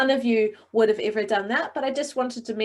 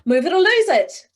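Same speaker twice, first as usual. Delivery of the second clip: high, louder, bright burst; excited; 8 semitones higher, 8 dB louder than usual. Shown as a demonstration. Coach demonstrating an excited take for the class.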